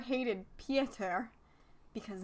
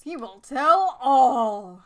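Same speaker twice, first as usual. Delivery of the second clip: dramatic announcer; 3 semitones higher, 12 dB louder, much slower than usual. Acted. Dramatic recap podcast voice.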